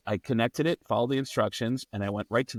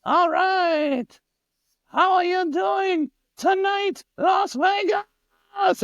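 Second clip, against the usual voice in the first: high, slow, stretched German rock-singer shout; 6 dB louder, much slower than usual. Retold or imitated. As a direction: belt it like a frontman greeting the arena.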